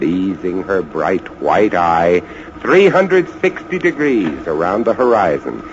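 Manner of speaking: ordinary talk, nothing marked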